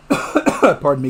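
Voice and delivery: coughing fit, then an apology. Real, not acted. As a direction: cough hard, then excuse yourself. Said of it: "I'm so sorry about the coughing".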